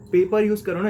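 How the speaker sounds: emphatic teacher nudge; loud urging. Real, not acted. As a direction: press the class to use paper.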